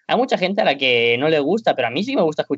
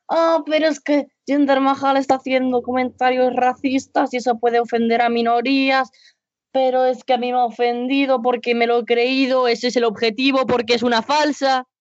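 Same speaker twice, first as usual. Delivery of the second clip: higher, mock-indignant imitation of a touchy fan; mocking an offended fan; 9 semitones higher than usual. Podcaster mocking fans offended by a wrestling promo, in a raised mock-indignant voice. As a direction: parody the easily offended viewer in a raised voice. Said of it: "hay gente que se está volviendo muy gilipollitas".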